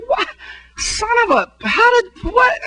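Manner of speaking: sputter, cut yourself off mid-curse; startled outrage. Retold or imitated. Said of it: "I'm like".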